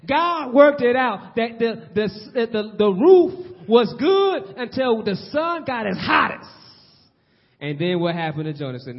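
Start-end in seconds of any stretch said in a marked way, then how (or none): 0.1-6.5 louder, higher, slower sermon build; rising pulpit fervor, slow and loud; fervent preaching. Acted. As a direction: preach it up to the punchline with a laugh.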